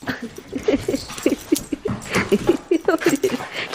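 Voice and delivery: high, breathless run of giggles; long helpless giggle; laughing fit. Real, not acted. Laughing at the pets.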